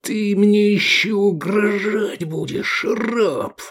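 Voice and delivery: slow, high-pitched menacing hiss; angry hiss. A narrator's reading. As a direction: hiss it as a drawn-out threat. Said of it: "прошипел Децим Юни". (злобное шипение, медленно и высоко)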